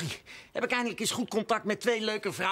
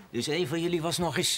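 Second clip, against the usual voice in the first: normal talking voice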